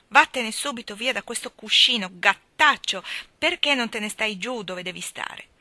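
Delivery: loud, cross telling-off of the cat; scolding the cat. A narrator's reading. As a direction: shoo the cat off the cushion, sharply and loudly.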